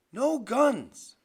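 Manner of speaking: high, loud refusal; angry objection. Acted. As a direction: forbid it sharply at full voice. Graham objects in the quarrel.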